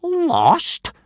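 high scoffing echo; snorting scorn. A narrator's reading. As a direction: snort at the very idea. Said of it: "snorted Gobber".

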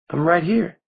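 put-on high, eerie young girl; a dead girl teasing gently; small girlish voice. A narrator's reading. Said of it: "as small as ever, barely a breath".

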